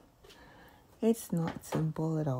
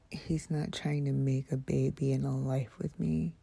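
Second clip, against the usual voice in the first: usual speaking voice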